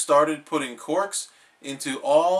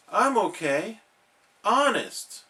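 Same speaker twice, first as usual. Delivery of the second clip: pleading; 7 semitones higher than usual. Acted.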